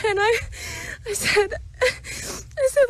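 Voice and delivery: barely getting the words out; choked up. Real, not acted. Telling what she said to the Lord, choked up.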